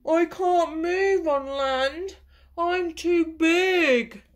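a lightly voiced whale moaning in despair; the beached whale moaning to the snail. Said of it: "moaned the whale".

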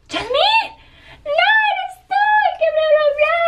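very high, squealed greeting; acted excited squealing. Acting out hugging her friend at the airport, high excited squeal. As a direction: re-enact an overexcited reunion shriek. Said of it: "hasta se los voy a actuar".

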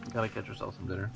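normal talking voice

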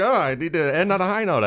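high, loud self-mocking exclamation; high-note ending. Host explaining his excited segment endings to co-hosts, high-pitched and loud. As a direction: push it up high, poking fun at yourself. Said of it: "I'm just like".

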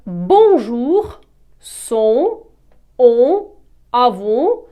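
bonjour, son, on, avant loud, with nasal vowels; bonjour, son, on, avant as spaced nasal models, N not pronounced; nasal pronunciation. Shown as a demonstration. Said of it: "будуть читатися з носовою вимовою".

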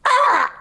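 short, high parrot squawk; startled bird yelp. Acted.

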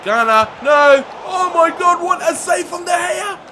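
high, loud relieved yell; excited shouting. Real, not acted. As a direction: shout in disbelief at the save. Big save celebrated.

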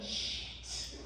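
whispered self-talk; hushed inner thought. Retold or imitated. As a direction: mutter it to yourself, stunned. Inner thought watching the show.

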